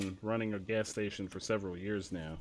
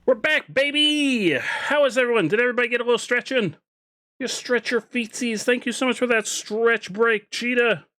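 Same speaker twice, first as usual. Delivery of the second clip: excited; 13 semitones higher, 8 dB louder than usual. Real, not acted. Hyped return from break.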